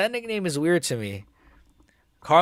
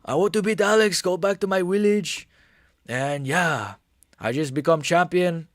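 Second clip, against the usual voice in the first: put-on higher, accented fighter voice; Yuri impression; 3 semitones higher than usual. Streamer does his best Yuri impression. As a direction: speak as the proud fighter going home. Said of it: "my best ever impression".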